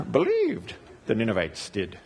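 low, laughing Yoda-style Believed the Ninevites did; a light chuckling Yoda imitation; Yoda speak. Pastor jokes Hebrew sounds like Yoda, lightly laughing Yoda voice. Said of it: "It's kinda like Yoda speak".

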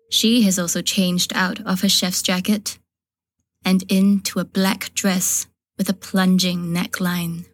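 usual speaking voice

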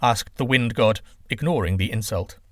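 ordinary delivery, nothing marked

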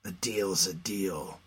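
low, quiet, slow resigned man; low grim voice. Acted. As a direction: say it like a weary bargain. Scarab answers.

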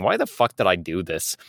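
ordinary talk, nothing marked

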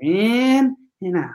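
slow, drawn-out "in and out" on the in-breath; slow breathing cue. Shown as a demonstration. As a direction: stretch "in" like a guided breath. Breathing exercise with the child.

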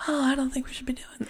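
put-on whispered British accent; a hushed British accent, lightly done. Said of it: "that's a British accent".

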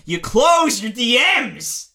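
slow, high, loud shout. Real, not acted. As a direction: bark the order slowly and loudly. Shouted advice in rant.

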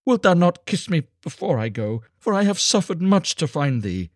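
high, loud pleading; impassioned, sorrowful appeal; anguished cry. A narrator's reading. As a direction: beg for one last kindness. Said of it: "she cried".

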